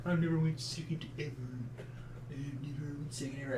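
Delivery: quiet fast mocking echo; mocking whine. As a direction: parrot him back mockingly. Partner mocks the complaint.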